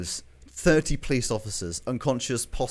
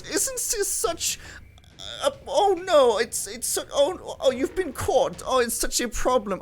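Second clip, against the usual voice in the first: high, faltering bear in mock dismay; theatrical bear character; 11 semitones higher than usual. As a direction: act flustered in a high put-on voice. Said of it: "And he says".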